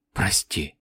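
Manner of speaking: very soft low broken whisper. A narrator's reading. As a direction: barely breathe the word. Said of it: "прошептала девочка".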